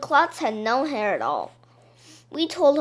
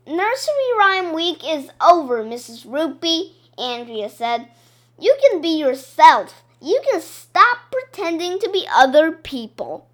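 put-on higher, louder exasperated girl; girl losing patience, louder; angry Andrea; 5 semitones higher, 7 dB louder than usual. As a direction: scold the librarian with rising frustration. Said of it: "Andrea was getting all angry now".

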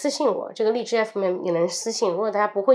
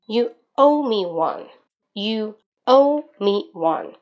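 slow model phrase; 4 dB louder, slower than usual. Shown as a demonstration. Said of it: "好，第三句".

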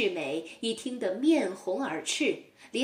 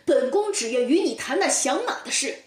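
angry, louder retort; 怒气冲冲; 3 semitones higher, 5 dB louder, faster than usual. Acted. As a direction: snap back in a temper. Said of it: "陈世美怒气冲冲地说". (陈世美怒气冲冲地回绝包公)

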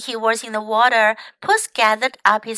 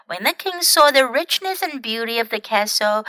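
usual speaking voice

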